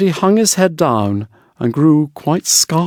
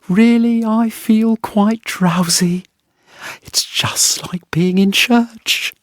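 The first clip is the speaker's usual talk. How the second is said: slow, higher, sleepy wife; a drowsy wife sinking into her ale; 8 semitones higher, slower than usual. A narrator's reading.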